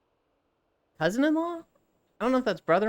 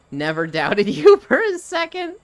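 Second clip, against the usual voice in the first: reading it out while breaking into laughter; cracking up mid-sentence, louder and higher; laughing through it; 6 semitones higher, 7 dB louder than usual. Retold or imitated. Retelling the mixed-up cake, laughing while reading it.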